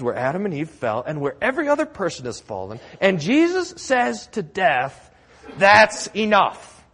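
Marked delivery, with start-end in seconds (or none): 3.0-5.1 full force preaching. Acted. Pastor hammers home Jesus' victory, fervent and loud. 5.6-6.8 commanding rebuke. A narrator's reading. Jesus commands death.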